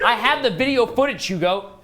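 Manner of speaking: loud, high-pitched protest; heated insistence. Real, not acted. Player defending his claim to Hugo, heated insistence. Heated argument over who invented what.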